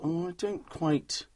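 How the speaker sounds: slow, hesitant unconvinced wife; unimpressed wife. Retold or imitated. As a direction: drag it out, unsure and unimpressed. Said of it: "she'll either go".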